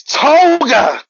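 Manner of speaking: high, loud drawn-out yell; screaming. Acted. Ochako charging at Toga mid-fight, screaming her name. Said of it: "And then she screams".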